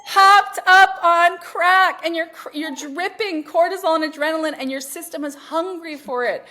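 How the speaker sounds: high, loud, drawn-out tirade; loud exasperated confrontation. Acted. Coach confronting clients hooked on stress, loud and exasperated. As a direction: hammer each word in acted disbelief.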